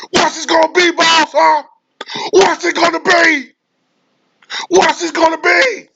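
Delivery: very high, whiny mocking taunt; mock taunting boss. Owner taunting the traded player, very high mock whine. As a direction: squeak it out like a bullying boss.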